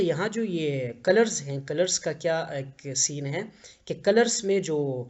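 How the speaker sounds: ordinary talk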